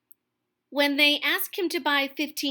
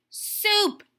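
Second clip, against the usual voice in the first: a hint of a snarl, higher and louder; 8 semitones higher, 4 dB louder than usual. Varmint snapping at Buddy, mild snarl. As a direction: bite off the word. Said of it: "snarled the scruffy varmint".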